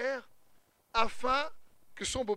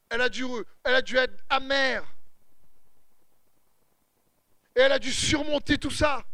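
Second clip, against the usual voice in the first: louder, higher, building sermon; rising impassioned preaching; preaching at full force; 5 semitones higher, 5 dB louder than usual. Real, not acted. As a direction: drive each line home to the crowd.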